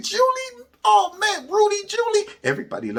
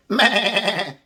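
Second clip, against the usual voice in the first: bleating laugh like a sheep; 2 semitones higher than usual. Pretend.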